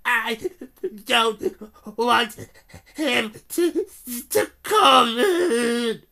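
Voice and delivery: put-on a spoilt boy wailing to get his way; hammed-up pretend sobs; fake sobbing yell. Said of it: "yelled between huge pretend sobs".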